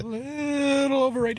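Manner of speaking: slow, high, drawn-out copy; upper register. Shown as a demonstration. Host trying the segment voice as instructed, upper register. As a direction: echo the squeaky upper-register voice. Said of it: "you have to do it in that voice".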